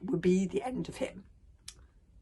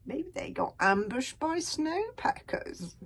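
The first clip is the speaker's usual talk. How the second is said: higher, slow mocking sneer; 8 semitones higher, slower than usual. A narrator's reading. Snotlout mocking Hiccup to Gobber, sneering. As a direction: drawl out a sly sneer. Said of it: "sneered Snot-lout".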